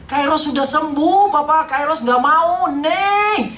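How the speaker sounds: long, drawn-out high shout; child's scream. Retold or imitated. Kairos refusing treatment to papa, imitated drawn-out scream. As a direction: scream it slowly, word by word, as a defiant boy. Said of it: "dia teriak seperti itu".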